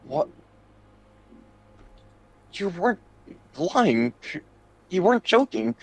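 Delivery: high, startled disbelief; rising in shock, then rushed; surprised Count. Acted. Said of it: "the Count looks around going".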